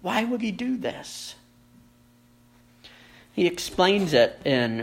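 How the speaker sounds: normal talking voice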